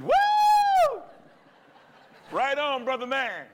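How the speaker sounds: high, loud whooping crowd voice, imitating a New York park audience; cheering New York crowd. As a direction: holler it like a fan in the park. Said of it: "they went".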